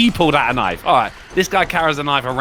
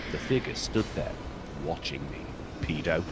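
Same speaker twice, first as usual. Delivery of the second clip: low, hushed horror narration; creepy story narration; 9 semitones lower, 9 dB quieter than usual. Acted. Streamer reading a creepypasta to chat, hushed and ominous. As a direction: spin a scary tale for the viewers.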